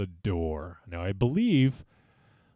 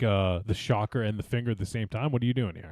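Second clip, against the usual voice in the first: usual speaking voice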